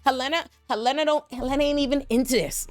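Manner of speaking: high pleading for Helena; pleading outcry. Real, not acted. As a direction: beg them to spare her, high and urgent.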